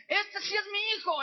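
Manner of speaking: put-on weep as you claim your child; a tearful mother's claim; crying mother. Mother recognizes her son.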